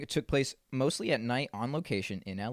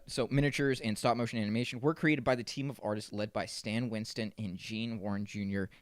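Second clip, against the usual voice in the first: normal talking voice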